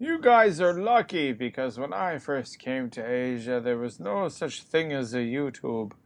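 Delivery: put-on slow creaky old-timer scolding the youngsters; old man waving his cane, slow. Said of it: "I say this in my old man voice waving my cane".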